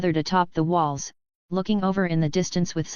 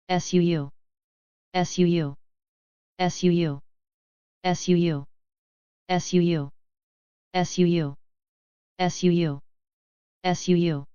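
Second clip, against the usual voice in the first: arrow whoosh. A narrator's reading. Arrows whistling through air.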